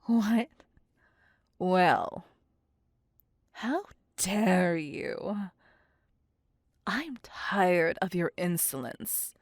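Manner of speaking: sudden loud indignant anger; offended outburst. Acted. Vampire insulted by her captive's face, angry outburst. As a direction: snap from teasing into fury.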